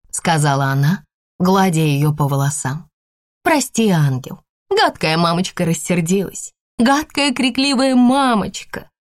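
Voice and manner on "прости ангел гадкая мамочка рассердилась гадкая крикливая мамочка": put-on soothing a child, slowing at the end; tender mother. A narrator's reading. (нежный материнский голос)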